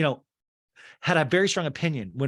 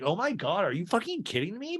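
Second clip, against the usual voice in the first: high, incredulous mock outrage; mock disbelief; 8 semitones higher than usual. Watching in bed, mock shocked at Lisa. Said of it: "in my bed, I was like".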